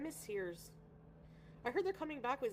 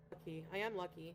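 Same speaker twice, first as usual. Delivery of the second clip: ordinary talk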